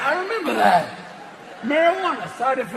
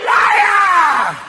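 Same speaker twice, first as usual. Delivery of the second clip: screaming "Liar!" much higher and louder; mock shout; 5 semitones higher, 4 dB louder, much slower than usual. Talking butt yells at the politician, loud. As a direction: yell it like an outraged heckler.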